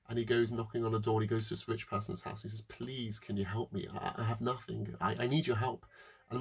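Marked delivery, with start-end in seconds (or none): 2.6-5.9 quiet pleading. Acted. Poor man begging.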